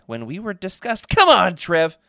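incredulous, rising to a loud groan of complaint; mock exasperation. Real, not acted. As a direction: balk at the misprint, voice jumping up. Balks at misprint in note.

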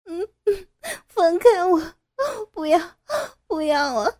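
high, loud tearful begging; crying and pleading. Acted. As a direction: sob the pleas, breaking at each no. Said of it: "不断地哭喊".

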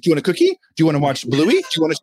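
put-on bribe a crying toddler, quickly; eager parent offering. Dad acting out bribing his upset toddler, coaxing. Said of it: "I realized, I was like".

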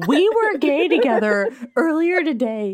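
high, loud imitation of a public scene; mock outburst. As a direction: blurt it out loudly, hammed-up. Imagined drunken outburst before everyone.

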